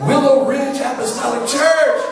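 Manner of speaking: high, proclaiming read-out; ringing mock-grand announcement; grand sign announcement. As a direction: proclaim it like a big banner, stretching the end. Reads out the big church sign.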